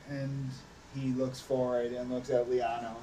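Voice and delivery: ordinary delivery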